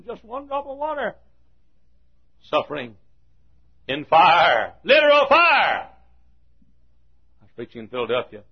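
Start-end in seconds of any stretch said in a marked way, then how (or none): 0.1-1.2 high, soft, thin plea; pleading. Acted. Rich man in hell begging for water, soft high pleading. 2.5-5.9 rising to a drawn-out holler; emphatic preaching. Real, not acted. Preacher insisting hell is real fire, emphatic rising holler. Preacher drives home hellfire.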